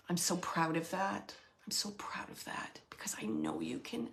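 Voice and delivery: hushed, breathy voiceless words of pride, fighting back tears; tearful whisper. Retold or imitated. Medium passing on the stepfather's pride to his stepdaughter, whispered. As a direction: whisper it like you're about to cry.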